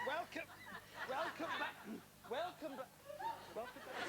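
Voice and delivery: high bright repeated greeting; excited welcome. Real, not acted. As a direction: welcome them back with glee. Host greets returning audience.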